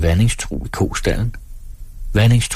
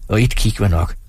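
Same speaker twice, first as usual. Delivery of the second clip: ordinary delivery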